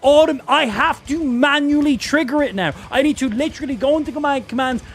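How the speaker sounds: high, agitated, bewildered protest; exasperated rant. Real, not acted. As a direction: rant in baffled outrage, stumbling over restarts. Ranting at unexplained game misfire.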